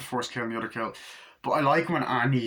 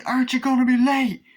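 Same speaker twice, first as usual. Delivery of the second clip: far higher and louder mock dad shout; high shouted correction; 13 semitones higher, 7 dB louder than usual. Shown as a demonstration. Fan correcting himself, doing the dad's shout high and loud. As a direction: yell it up high the way he does. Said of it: "No, say".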